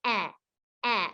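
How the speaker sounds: "eh" as a brief vowel, as in bed; short, separate "eh" sounds. Shown as a demonstration. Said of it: "Short E says".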